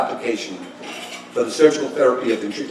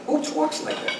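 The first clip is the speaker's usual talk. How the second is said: incredulous aside; 14 semitones higher, 7 dB quieter than usual. Retold or imitated. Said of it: "I said".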